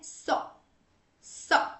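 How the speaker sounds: "सॉ" with a short open o, as in sock; correct open o. Shown as a demonstration. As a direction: open and round the vowel in "सॉ". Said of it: "सही sound अगर हमें लाना है". ("सॉ" में छोटा, खुला ऑ)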